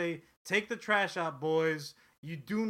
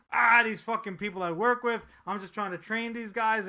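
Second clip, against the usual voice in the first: loud, higher griping boss voice; louder mock-frustrated office manager; Michael Scott griping; 5 semitones higher, 9 dB louder than usual. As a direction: rant like a fed-up office boss. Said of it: "he's like".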